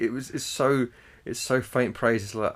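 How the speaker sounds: ordinary talk, nothing marked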